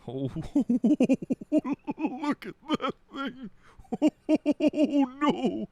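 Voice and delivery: high squeal of laughter; laughing. Real, not acted. Reacts to creepy creature.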